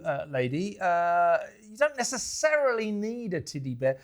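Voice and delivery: usual speaking voice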